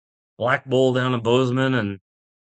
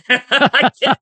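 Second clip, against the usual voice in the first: ordinary talk, nothing marked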